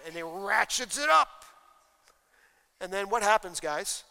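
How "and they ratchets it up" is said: ordinary talk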